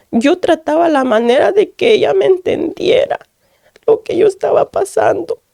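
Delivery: fighting back tears while telling it; real pain rising in the voice; near tears. Real, not acted. Tearful testimony to the pastor.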